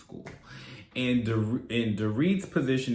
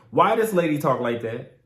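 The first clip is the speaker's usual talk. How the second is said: usual speaking voice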